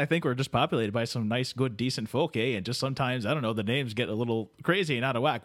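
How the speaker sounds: ordinary talk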